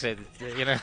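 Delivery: ordinary talk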